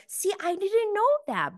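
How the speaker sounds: high, put-on voice imitating Monica; mock Monica. Monica defending herself, imitated in a high voice. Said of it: "And Monica's like".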